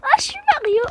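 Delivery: high, loud Mario cheer; Mario again. Pretend. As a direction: belt it out as Mario, full of glee. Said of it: "j'adore ce, euh, personnage-là". (Mario crié très aigu)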